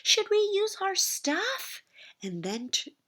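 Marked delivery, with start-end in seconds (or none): 0.0-1.8 put-on high, slow squeal of baby skunks; little skunks squealing to each other, high and slow. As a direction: squeal it, sweetly mischievous.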